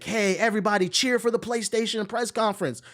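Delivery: high, chirpy mock announcer calling for cheers; mock hype man. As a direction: ham up a PR guy rallying the crowd. Mocking Sony staff hyping crowd.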